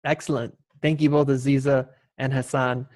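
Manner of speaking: ordinary delivery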